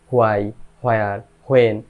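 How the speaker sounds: হোয়াই, হোয়ার, হোয়েন all opened on h; habitual mispronunciation. Shown as a demonstration. Teacher recaps learners' mistake, h-initial হোয়াই, হোয়ার, হোয়েন. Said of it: "এই হ উচ্চারণ হবে না".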